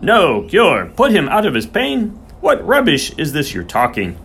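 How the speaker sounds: high, loud, incredulous echoing; outraged disbelief. A narrator's reading. Groom hears there is no cure, outraged disbelief. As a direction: fling the words back in scorn. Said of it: "Soames' face screwed up".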